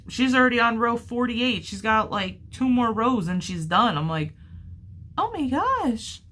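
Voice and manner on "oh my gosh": amazed. Retold or imitated. Seeing friend's bigger blanket.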